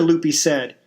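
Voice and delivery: ordinary delivery, nothing marked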